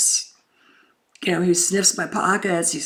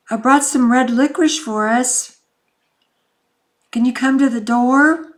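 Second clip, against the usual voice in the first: bright, louder coaxing through the door; coaxing call; 5 semitones higher, 6 dB louder than usual. Retold or imitated. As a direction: coax him to open up. Coaxing him to open up.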